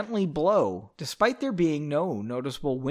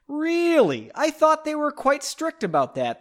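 put-on high, loud concerned old man; concerned surprise; 11 semitones higher, 4 dB louder than usual. A narrator's reading. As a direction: ask with worried disbelief. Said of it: "the man said with some concern".

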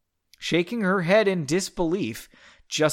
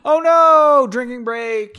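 gasp, then a high loud cry; hammed-up panic easing into an announcement; mock alarm; 8 semitones higher, 11 dB louder, slower than usual. Host reacting to story cliffhanger, loud mock-alarmed cry.

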